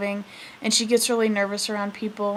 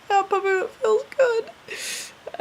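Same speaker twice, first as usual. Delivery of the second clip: strained, much higher and very slow, near tears; trying not to cry; 12 semitones higher, much slower than usual. Retold or imitated. Cousin forcing agreement through pain, near tears. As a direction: squeeze the words out holding back a sob. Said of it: "trying not to cry".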